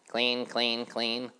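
high busy housefly chanting; sing-song of a tidy little fly. A narrator's reading. Housefly sweeping the road, chanting brightly and high.

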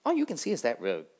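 loud, high, boastful dragonflies; brash dragonfly voice. Acted. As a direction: call out high and loud, showing off.

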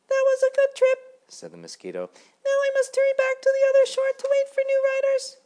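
very high, loud squeaky little mosquito; a tiny self-important ferryman insisting on rules. A narrator's reading. Mosquito ferryman lecturing Grasshopper, very high and loud.

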